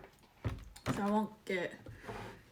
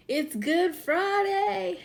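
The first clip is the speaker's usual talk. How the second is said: sing-song high; 8 semitones higher, 4 dB louder, much slower than usual. Real, not acted. Sing-song announcement of holiday.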